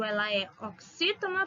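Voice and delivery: ordinary talk, nothing marked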